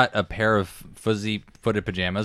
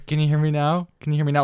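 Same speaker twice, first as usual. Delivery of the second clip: phone guy voice; 6 semitones higher than usual. Retold or imitated. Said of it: "with the phone going".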